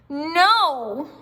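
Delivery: flat refusal. A narrator's reading. Lucille sulkily refusing her mother's offer.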